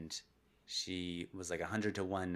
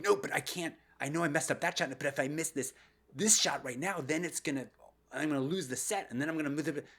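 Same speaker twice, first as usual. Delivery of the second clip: anxious tennis player; 5 semitones higher than usual. Acted. Panicking tennis player voiced.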